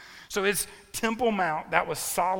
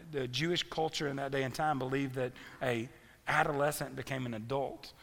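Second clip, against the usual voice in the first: normal talking voice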